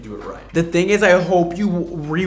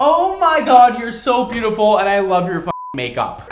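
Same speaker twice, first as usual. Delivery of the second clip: excited gushing; 10 semitones higher, 7 dB louder than usual. Real, not acted. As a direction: gush over the look, much higher. Gushing over a makeover.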